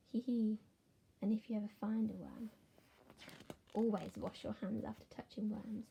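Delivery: plain reading voice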